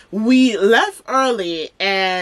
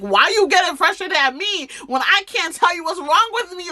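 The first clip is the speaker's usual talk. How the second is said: high-pitched exasperated outburst, acted out; frustrated; 10 semitones higher than usual. Snapping back at scared mom in a retold call, frustrated. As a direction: protest in a much higher voice, acting out the frustration. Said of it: "I'm getting frustrated".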